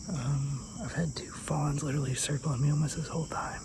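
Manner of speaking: hushed hunting-stand whisper. Real, not acted. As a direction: keep it whispered so the deer don't hear.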